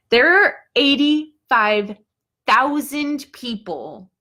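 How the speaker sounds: "eighty-five thousand" slow, high and punched; emphatic. Real, not acted. Storyteller stressing odds of "eighty-five thousand", slow and emphatic. Stresses staggering number of graves.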